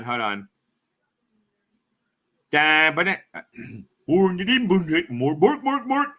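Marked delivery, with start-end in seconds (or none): 2.5-6.1 sing-song nonsense chef babble; half-successful mock-Swedish gibberish, bouncing pitch. Streamer failing at an impersonation, Swedish Chef babble. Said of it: "I know the Swedish fucking chef".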